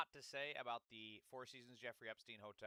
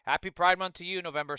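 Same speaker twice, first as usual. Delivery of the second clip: much louder and higher greeting; too loud; 7 semitones higher, 22 dB louder than usual. Real, not acted. Greeting a viewer with the mic turned up, too loud. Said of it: "oh, that's way too loud".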